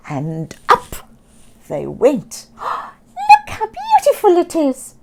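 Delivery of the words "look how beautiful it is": high, loud delighted squawk. Acted. Sammy showing Oscar the sea, high delighted squawk. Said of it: "Sammy squawked".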